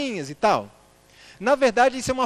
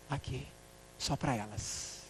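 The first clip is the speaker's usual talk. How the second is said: low intimate whisper; 7 semitones lower, 16 dB quieter than usual. Retold or imitated. Preacher imitating [name] singing only for women, whispered. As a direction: hush it as if singing close to her ear. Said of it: "[name] cantava sussurrando".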